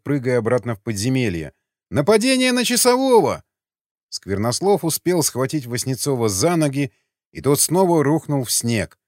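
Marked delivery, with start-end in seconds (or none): 1.9-3.4 much higher and louder shriek for help; panicked yell. A narrator's reading. The guard cries for help, fleeing underground in panic.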